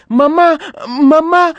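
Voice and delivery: very high, loud cry for mother; high, drawn-out alarmed call; children crying out. A narrator's reading. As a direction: shriek for mum like alarmed children. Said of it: "les cris de leurs frères".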